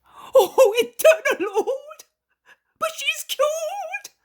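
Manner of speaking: overjoyed cry. Acted. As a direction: burst out in joyful disbelief. Father sees daughter healed.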